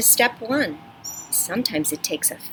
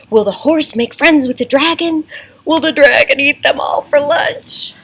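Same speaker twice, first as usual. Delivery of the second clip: higher, louder suspenseful questions; rising suspense; building excitement; 8 semitones higher, 6 dB louder than usual. Shown as a demonstration. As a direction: crank up the drama with each question. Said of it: "make sure your voice sounds exciting too".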